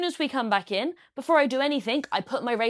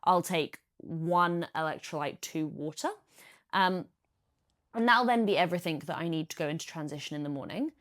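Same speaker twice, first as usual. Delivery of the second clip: usual speaking voice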